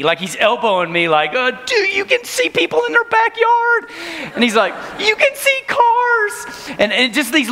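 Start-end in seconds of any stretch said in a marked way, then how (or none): 1.4-3.9 high-pitched giddy excited voice; giddy kid. Retold or imitated. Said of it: "he was so giddy". 5.0-6.8 high-pitched giddy excited voice; giddy kid. Retold or imitated. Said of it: "He was so giddy".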